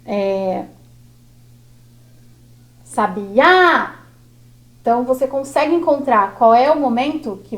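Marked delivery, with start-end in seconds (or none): 2.8-4.0 "sabiá" called out, rising onto "á"; "sabiá" chamado, tônica no "á"; calling the word. Shown as a demonstration. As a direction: call "sabiá" leaning on the last "á". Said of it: "vamos pegar mais uma".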